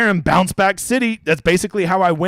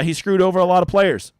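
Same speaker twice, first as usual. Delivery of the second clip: ordinary talk, nothing marked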